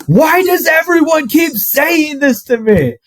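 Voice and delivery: high, slow outraged yell; exasperated shout. Retold or imitated. Grandson yelling at his grandparents, high exasperated shout.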